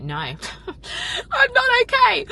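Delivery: high laughing wail, loud and slow; laughing through tears. Retold or imitated. Retelling her answer to Greg, high laughing wail. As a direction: laugh out a teary no as she tells Greg.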